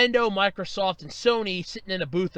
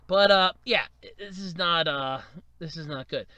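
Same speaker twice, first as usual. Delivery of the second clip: ordinary talk, nothing marked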